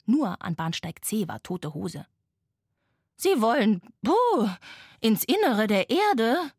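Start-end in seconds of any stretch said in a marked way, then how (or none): 3.2-6.5 loud huffing tin locomotive with a high puff; puffing old train. A narrator's reading. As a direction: puff and wheeze like a rusty engine. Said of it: "schnaufte eine verrostete Blecheisenbahn". (schnaufende, verrostete Blecheisenbahn)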